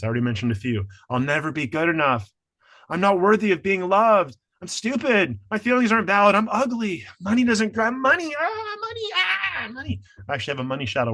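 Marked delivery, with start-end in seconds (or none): 1.1-8.3 rising, louder whiny self-talk; mocking limiting beliefs. Voicing the inner critic's beliefs, higher and louder. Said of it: "examples of limiting beliefs". 8.4-10.0 squeaky high mock wail of money; hammed-up wail. Mocking money worries with a squeaky high wail. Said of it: "exaggerated money cry".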